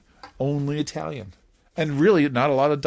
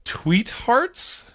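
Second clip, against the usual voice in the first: high, incredulous echo; groaning disbelief; 11 semitones higher than usual. Real, not acted. Host echoes the child's pun, high and incredulous. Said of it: "Oh, my goodness".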